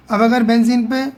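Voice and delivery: ordinary talk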